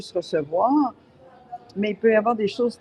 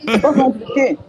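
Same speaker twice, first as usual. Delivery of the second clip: mouth full; 5 semitones higher, 7 dB louder than usual. Real, not acted. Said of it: "je mange, ce sera pas long".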